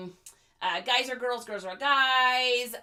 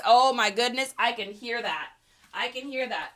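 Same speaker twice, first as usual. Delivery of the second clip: normal talking voice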